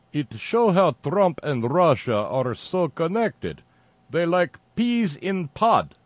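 put-on thick Russian accent. Host quoting activist Ryan Clayton, thick Russian accent. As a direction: do a thick, bad Russian accent, drawn out and emphatic. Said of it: "responded in a thick Russian accent".

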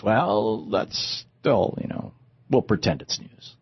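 low, soft flunky aside; smooth the boss over quietly; placating advisor. Acted. Advisor placating acted Murdoch.

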